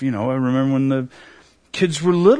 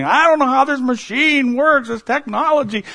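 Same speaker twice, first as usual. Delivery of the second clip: high, whiny feigned cluelessness; mock helpless husband; 8 semitones higher than usual. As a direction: play dumb to dodge the laundry. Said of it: "when my wife is asking me to do laundry".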